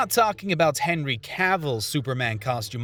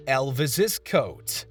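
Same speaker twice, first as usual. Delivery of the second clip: top ten countdown; 2 semitones lower, much slower than usual. Acted. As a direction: announce the next entry slowly. Countdown item title announced.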